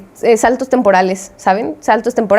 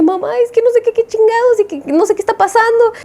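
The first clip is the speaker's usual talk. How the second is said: much higher, frantic pleading to mom; shocked panic; 11 semitones higher than usual. Retold or imitated. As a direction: blurt it out in a panic to your mother. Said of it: "estaba tan conmocionada". (pánico, mucho más aguda, suplicándole a mamá)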